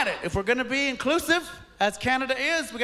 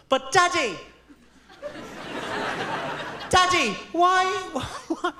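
put-on high, whiny little girl asking why; bad impression of a small daughter pleading; 8 semitones higher, much slower than usual. Comic dad mimicking his daughter's high whine. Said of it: "That is not a good impression of my daughter".

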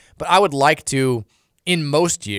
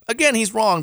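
high mock insistence; 11 semitones higher than usual. Mock emphatic correction of texter.

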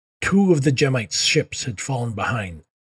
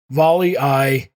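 shouted reply; 6 dB louder, slower than usual. Acted. Crew echoes the warning.

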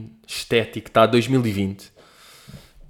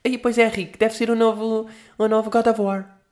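put-on young kid voice; 10 semitones higher than usual. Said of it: "um putinho tipo".

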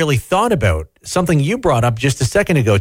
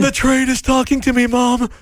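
put-on child voice; 9 semitones higher than usual. Child voice joining the bit.

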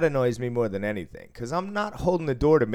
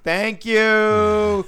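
high, slow, mocking thank you; sing-song teasing thanks, pitched way up; 13 semitones higher, 11 dB louder than usual. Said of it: "I just kept joking".

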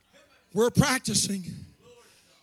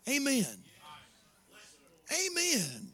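soft amen; 10 dB quieter, slower than usual. Shown as a demonstration. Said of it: "you might just sit there and go".

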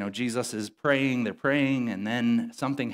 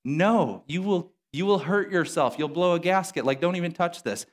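higher-pitched mock alarm; mock exasperated warning; 7 semitones higher than usual. Gym lifters warning the cleaner off the weight, mock exasperated. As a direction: wave him off, voice pitched up.

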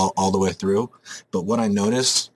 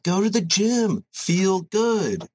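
exasperated rant; 9 semitones higher, much slower than usual. Acted. Ranting at fitness influencers.

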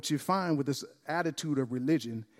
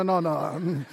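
usual speaking voice

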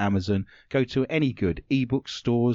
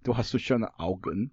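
mock German accent; 3 semitones lower, 4 dB quieter than usual. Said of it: "we have Germany".

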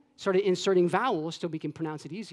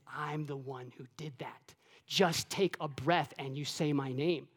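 much softer, slow, intimate; hushed voice of God; 6 semitones lower, 11 dB quieter than usual. Acted. Preacher voicing God telling the church about breath, hushed. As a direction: let God speak almost under the breath.

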